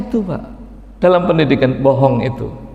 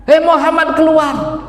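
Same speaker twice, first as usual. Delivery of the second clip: rude shout; 12 semitones higher than usual. Retold or imitated. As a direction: shout rudely through the door.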